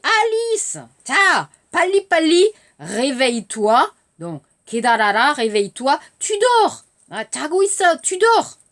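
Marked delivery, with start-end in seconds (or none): 0.0-2.4 calling out. Acted. Mother rousing sleepy Alice. 6.2-8.6 mother scolding. Acted. Mother rousing sleepy Alice.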